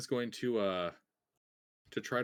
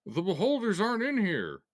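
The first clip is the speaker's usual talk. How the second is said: gruff dwarf voice; 7 semitones higher, 5 dB louder than usual. Acted. Replying in dwarf voice.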